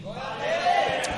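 high, slow joyful call. Real, not acted. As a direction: cry out glory to God. Congregation call and response.

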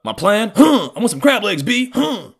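loud, high voice with huffing interjections; imitated father. Dad answering son about his plan, high loud caricature. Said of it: "He's like".

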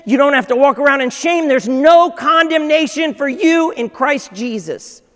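loud, high, driving sermon climax; impassioned preacher rising to a peak; full-force preaching. Acted. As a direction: proclaim it to the whole room.

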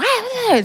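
imitating the screams heard over the phone; imitated screams. Retelling what the aunt heard on the phone, a high mock scream. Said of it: "porque se escuchaba".

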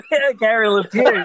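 laughing loudly through the name. Real, not acted. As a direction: tease him while laughing. Teasing with a nickname.

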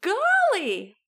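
high, loud surprise; storybook astonishment; startled exclamation. A narrator's reading. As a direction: blurt it as if shocked. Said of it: "And Hugh said".

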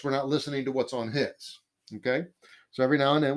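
normal talking voice

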